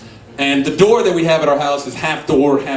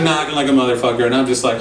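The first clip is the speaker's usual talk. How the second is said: usual speaking voice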